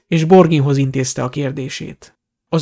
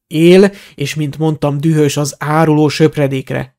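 plain reading voice

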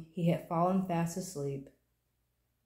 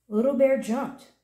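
plain reading voice

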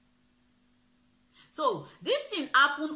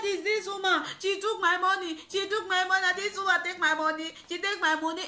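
retold accusation; 8 semitones higher than usual. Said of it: "I said".

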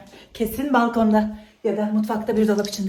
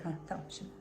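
unvoiced whisper from hiding; whispering while hiding; 7 semitones lower, 19 dB quieter, much faster than usual. Real, not acted. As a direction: breathe it so no one hears.

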